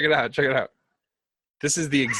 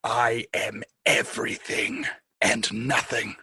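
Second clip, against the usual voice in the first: grand, ominous proclamation; dark lord voice; slower than usual. Acted. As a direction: intone it like an unknowable god. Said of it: "very well in the voice".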